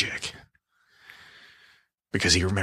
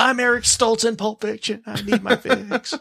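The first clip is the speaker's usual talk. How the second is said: high whiny addict voice; mock junkie; 13 semitones higher than usual. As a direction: plead for a fix, hammed-up. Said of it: "That's what he looks like".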